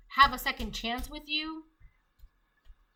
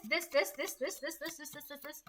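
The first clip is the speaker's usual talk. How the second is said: high, rapid repeats fading away softer; rattling off schemes; 4 semitones higher, 6 dB quieter than usual. Acted. Rival lists all they will do, mock obsessive rattling. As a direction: pile up the same word in a higher voice, dying out.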